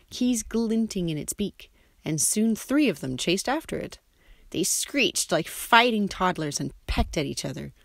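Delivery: ordinary delivery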